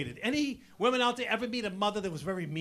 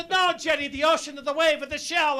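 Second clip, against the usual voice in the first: insistent mother; 5 semitones higher, 6 dB louder than usual. Retold or imitated.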